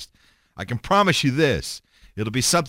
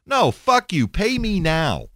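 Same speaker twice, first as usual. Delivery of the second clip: sudden higher, louder "no, fuck you"; defiant outburst; 9 semitones higher, 4 dB louder, slower than usual. Real, not acted. Podcaster refusing advertisers who pay late, defiant outburst. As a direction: throw it back in the sponsors' faces. Rejecting sponsors' pay-later terms.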